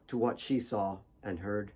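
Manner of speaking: usual speaking voice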